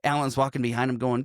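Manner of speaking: normal talking voice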